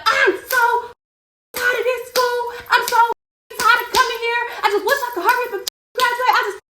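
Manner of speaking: high, sobbing, shouted rant about school; tearful furious outburst, voice cracking high; crying rant. Retold or imitated. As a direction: let it all out through tears, fist pounding. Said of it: "just going off. And tears was coming down my face".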